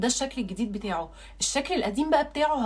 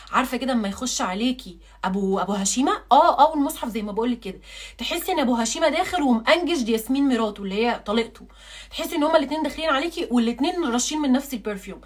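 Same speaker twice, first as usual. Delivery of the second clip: ordinary talk, nothing marked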